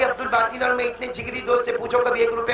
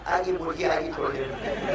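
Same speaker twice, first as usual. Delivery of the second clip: hopeful thought; 9 semitones lower, 9 dB quieter than usual. Retold or imitated. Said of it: "मौलाना सोचा".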